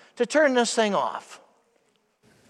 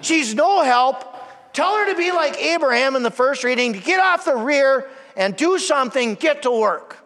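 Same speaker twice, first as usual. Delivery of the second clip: a touch of huffy indignation, pitched up on Jesus; mock indignant complaint; 6 semitones higher, 3 dB louder than usual. Martha complaining to Jesus about Mary, mildly indignant. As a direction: play Martha griping about her sister, lightly. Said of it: "and I quote".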